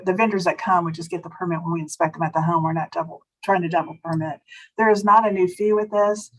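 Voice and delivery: ordinary delivery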